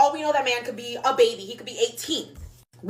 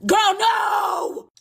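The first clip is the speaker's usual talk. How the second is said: high, loud drawn-out protest; imitated group outcry, pitched way up; exasperated shout; 7 semitones higher, 4 dB louder than usual. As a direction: shout down a friend's bad idea. Friends refusing drunk girl's plan.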